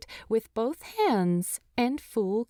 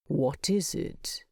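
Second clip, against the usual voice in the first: gruff Thorin; 2 semitones lower than usual. Acted. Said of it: "Thorin's voice is gruffer even than normal".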